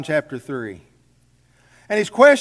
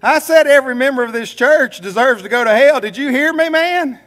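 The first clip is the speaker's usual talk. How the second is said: loud, high, pointed call to the back row; direct call-out; 7 semitones higher, 7 dB louder than usual. Retold or imitated. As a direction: call out the man in the back, hard. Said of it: "did the real direct thing".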